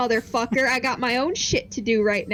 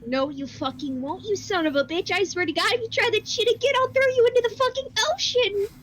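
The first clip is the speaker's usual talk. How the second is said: angry threat; 6 semitones higher than usual. Acted. Player threatening the departing presence with the ocean, rising anger.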